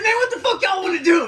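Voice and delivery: loud, high-pitched confrontational yell; retold shouted challenge. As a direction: square up and yell at the whole group. Acts out challenging the group.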